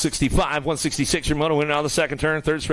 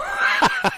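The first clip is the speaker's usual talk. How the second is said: laugh. Real, not acted. Laughs at own joke.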